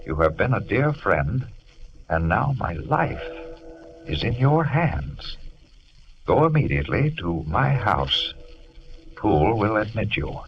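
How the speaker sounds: solemn letter reading. Acted. Jekyll's letter to Lanyon, read out solemnly. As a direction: read it like a desperate last request.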